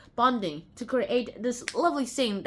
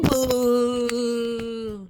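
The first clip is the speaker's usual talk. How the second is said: normal talking voice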